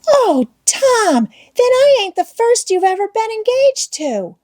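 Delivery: put-on high, crying little-girl wail; tearful wail. A narrator's reading. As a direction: wail as if heartbroken, voice way up. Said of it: "The child began to cry".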